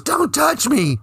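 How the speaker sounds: high-pitched indignant protest, imitated; hammed-up high outrage; mock outraged cry. Retold slap and outburst.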